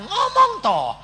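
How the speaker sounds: loud, high exclamation; exasperated urging. Retold or imitated. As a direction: call it out high, falling at the end. Retelling his urging to speak.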